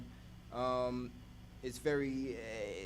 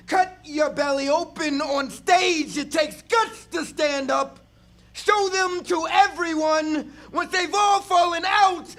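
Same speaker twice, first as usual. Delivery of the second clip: higher, louder impassioned shout; 18 dB louder, much slower than usual. Acted. Poet rising to a shouted climax before the audience. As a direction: hurl the lines at the crowd.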